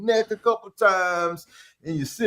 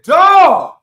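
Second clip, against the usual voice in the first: loud high shocked "Dog"; shocked shout; 10 semitones higher, 12 dB louder than usual. Retold or imitated. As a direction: blurt it out, stunned.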